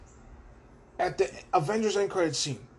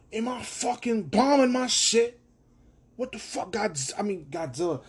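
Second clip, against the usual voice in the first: grumpy Kong; 6 semitones higher than usual. Retold or imitated. Voicing Kong grumbling at humans on his turf, mock gruff rant. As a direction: gripe like a fed-up giant ape.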